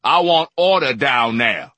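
loud commanding boom; booming demand for order. A narrator's reading. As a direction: fill the room with it. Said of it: "Supercargo boomed".

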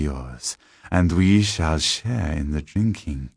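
slow, possessive vampire master; measured villain savouring his power; sinister tall man. Acted. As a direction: soothe her with lordly, lingering menace.

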